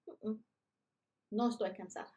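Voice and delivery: usual speaking voice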